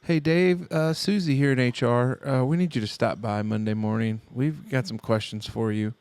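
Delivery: mock HR email. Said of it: "I can see the email now".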